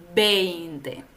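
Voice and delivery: veinte with a falling close, ei diphthong; model veinte. Shown as a demonstration. Teacher models veinte for students to repeat. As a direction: say veinte slowly for repetition. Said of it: "Repite después de mí".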